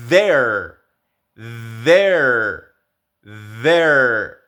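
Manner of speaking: there with a voiced th, each said with a falling tune. Shown as a demonstration. Teacher drilling students on there with a voiced th. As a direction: repeat there as a drill, buzzing the th. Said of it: "O palabras como".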